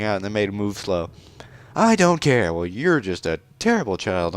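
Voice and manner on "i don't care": put-on high-pitched haughty young princess; haughty princess Ruto. Princess Ruto introducing herself to the stranger, high and proud.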